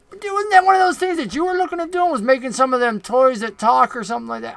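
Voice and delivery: high, prying goblin boss. Acted.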